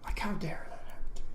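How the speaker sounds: whispered, breathy and private. Real, not acted. Vlogger muttering his self-pity to viewers, whispered. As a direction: let the complaint drop to a hushed whisper.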